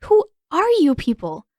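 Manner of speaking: high, loud and indignant; indignant demand. A narrator's reading. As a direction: demand your rights while struggling. Katara struggling against ropes, demanding.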